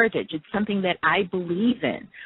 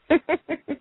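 quick amused giggle; short giggle; 9 semitones higher than usual. Real, not acted. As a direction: laugh at the card. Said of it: "I might giggle or laugh only because I know what the card means".

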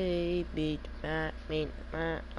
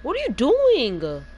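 louder and higher, slow, disbelieving what are you doing; exasperated yell; 7 semitones higher, 11 dB louder than usual. Real, not acted. Streamer yelling at random teammate, exasperated. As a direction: yell at a teammate who keeps messing up. Teammate misplays in online game.

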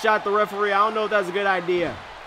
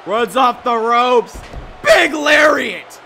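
excited shout; 4 semitones higher, 8 dB louder, slower than usual. Real, not acted. Big lariat move.

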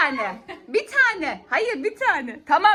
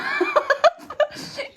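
high squealing laugh. Real, not acted. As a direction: burst of high giggling. Laughing at Ömer Asaf.

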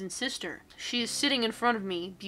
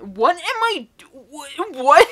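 high, louder stammered what; flustered disbelief; 6 semitones higher, 11 dB louder, slower than usual. Real, not acted. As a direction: trip over words in shock. Stunned reaction to the story.

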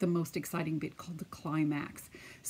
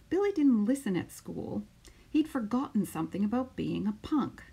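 put-on lively storytelling voice for children; picture book read-aloud; 4 semitones higher than usual. Teacher reading a picture book to her class, lively storytelling. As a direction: read it out like story time on the mat.

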